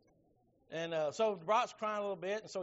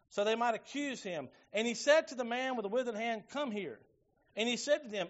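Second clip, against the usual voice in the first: raised, measured pulpit reading; scripture reading; 4 semitones higher, 4 dB louder than usual. A narrator's reading. Preacher reading Mark chapter three to the church, declaimed scripture reading. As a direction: read the verses out to the congregation, higher and firmer.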